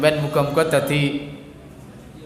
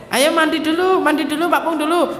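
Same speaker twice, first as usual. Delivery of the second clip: higher coaxing call to bathe; coaxing mother; 10 semitones higher than usual. Retold or imitated. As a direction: urge a child gently to the bath.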